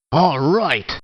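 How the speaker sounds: usual speaking voice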